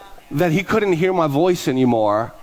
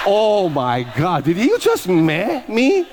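slow, rising, scandalized disbelief; mock outrage; 6 semitones higher, much slower than usual. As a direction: stretch every word and climb into a high, offended squeal. Acts outrage at the eye roll.

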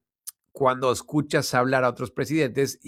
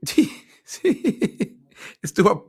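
laughing; 8 semitones higher, 5 dB louder, much slower than usual. Real, not acted. Laughing at the gaffe.

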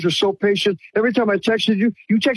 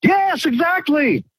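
thrilled agreement; 8 semitones higher, slower than usual. Real, not acted. Caller agrees it was free money.

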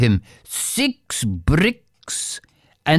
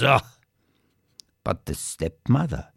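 ordinary delivery

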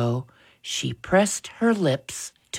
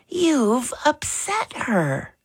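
high, slow motherly scolding; 9 semitones higher, slower than usual. A narrator's reading. Mother to son over upset sister, scolding. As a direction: scold him in a raised pitch. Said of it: "Mother scolded".

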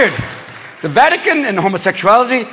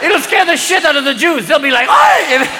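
much higher, excited stoner voice; giddy high cardinal talking over himself; stoned cardinals; 8 semitones higher than usual. Retold or imitated. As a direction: pitch a crazy idea while high and giggly.

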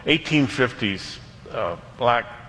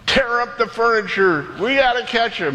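high, urgent mock command; rally the crew to give chase; acted-out desperate captain; frantic captain's order; 10 semitones higher than usual.

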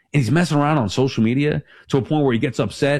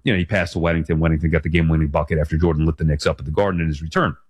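ordinary talk, nothing marked

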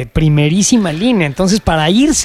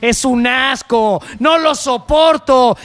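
hammed-up disgust, stretching the insults; drunken venting outburst; 6 semitones higher than usual. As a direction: let the real feelings spill out, imitating a fed-up drunk. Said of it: "Con Peláez ya borracho, cuando salen los sentimientos reales".

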